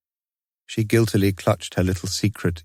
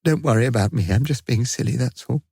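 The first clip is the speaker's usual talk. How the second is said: steadying herself; 4 semitones higher than usual. A narrator's reading. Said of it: "tried to steady her quivering voice".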